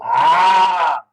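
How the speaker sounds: loud, drawn-out "aah" of recognition; excited "ah". Real, not acted. Coach seeing answers arrive in chat, excited "ah". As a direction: burst out with a long loud "ah".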